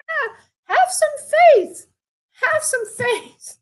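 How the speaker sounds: high, loud frantic pleading, drawn out; frantic mother. Retold or imitated. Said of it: "She's getting frantic".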